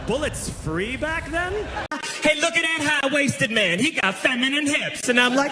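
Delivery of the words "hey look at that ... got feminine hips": high, raised mocking voice; jeering heckler. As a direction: call out a taunt, pitched way up. Acting out people jeering at him.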